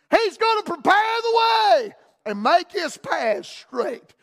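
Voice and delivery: God's promise thundered out to the congregation; booming proclamation. Acted. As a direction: proclaim it slowly like a prophecy. God's promise preached at climax.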